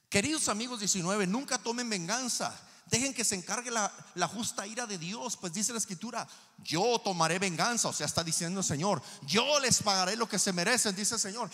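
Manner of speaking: emphatic scripture reading to a congregation; forceful preaching. Real, not acted.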